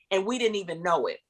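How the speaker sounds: normal talking voice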